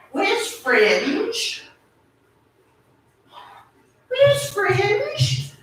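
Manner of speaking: high, lilting pet call; high sing-song call. Real, not acted. Calling for hidden pet.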